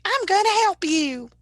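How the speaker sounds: high, loud Southern do-gooder; Southern accent. Pretend. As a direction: put on a hammed-up Southern voice to offer rescue. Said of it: "have to have a Southern accent".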